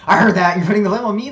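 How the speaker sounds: loud, high outburst of acted outrage; indignant boss. Gorgon catching an underling blaming him, loudly indignant. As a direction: bellow it like an offended villain boss.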